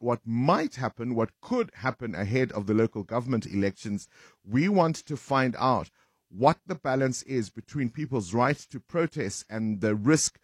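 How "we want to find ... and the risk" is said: ordinary talk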